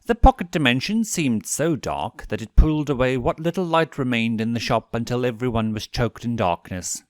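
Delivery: ordinary delivery, nothing marked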